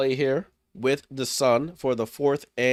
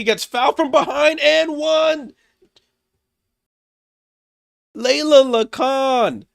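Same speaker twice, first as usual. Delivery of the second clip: higher, louder, climbing with the play; excited play-by-play; 7 semitones higher, 7 dB louder than usual. Real, not acted. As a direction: call the steal and the and-one like it's huge. Rookie scores and-one.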